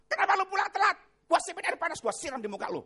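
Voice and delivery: loud, high tirade at husband; angry scolding wife. Acted. Wife berates husband for coming home late, angry shout. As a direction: lay into him, loud then easing.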